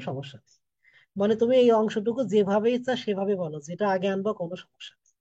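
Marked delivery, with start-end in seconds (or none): none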